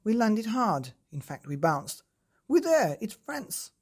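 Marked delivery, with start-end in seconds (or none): 2.5-3.7 high, louder triumphant toad announcing arrival; proud announcement. A narrator's reading. Toad telling the boy they reached France, a proud high announcement. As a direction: announce it like a grand finish. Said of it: "the Toad announced".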